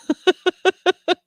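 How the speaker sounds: high burst of laughter. Real, not acted. Laughing at a joke answer.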